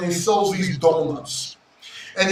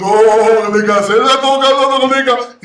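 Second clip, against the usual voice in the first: Orlikas's vendor call; 3 semitones higher, 7 dB louder, much slower than usual. Retold or imitated. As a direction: call out to the whole beach like a showman selling donuts. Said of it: "he does this shit with his voice".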